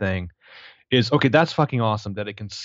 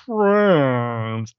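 doped-up Bogdan; 3 semitones higher than usual. Retold or imitated. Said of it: "And he's like".

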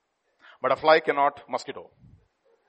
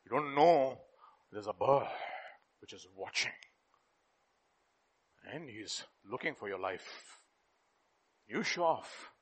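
low, soft ominous warning; hushed warning; 7 semitones lower, 8 dB quieter than usual. Acted. Preacher warning show-offs of danger, hushed and low. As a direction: drop the voice, make it a threat.

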